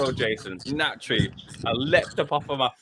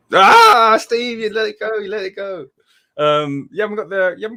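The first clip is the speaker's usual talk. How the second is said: high, loud mock-exasperated cry at Steve; exasperated teasing; 11 semitones higher, 11 dB louder than usual. Real, not acted. Guest teasing the host over a photo, loud and high. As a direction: pretend outrage, really drag it out.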